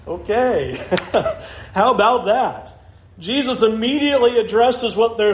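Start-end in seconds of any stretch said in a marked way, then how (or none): 0.1-2.7 nervous laugh. Retold or imitated. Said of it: "I'm just like".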